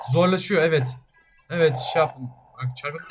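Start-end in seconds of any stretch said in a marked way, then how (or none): none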